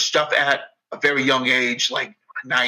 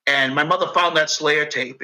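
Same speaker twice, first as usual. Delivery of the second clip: usual speaking voice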